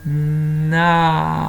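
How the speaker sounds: "Na" with a held n and long fading a; "Na" slowly stretched to expose both sounds; stretched syllable. Shown as a demonstration. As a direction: draw out "Na" as nnn-aaaa. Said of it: "je vais te faire dans l'autre sens".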